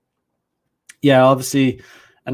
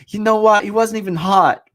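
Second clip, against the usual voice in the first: higher-pitched imitation stressing "what" and "hot"; emphatic retelling; 6 semitones higher than usual. Man repeating how his date emphasized it, mimicked emphatically. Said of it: "she was like, she emphasized".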